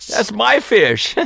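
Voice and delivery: high, slow put-on whine; mock indignant. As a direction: whine like a sore loser. Mock claim on hooked fish.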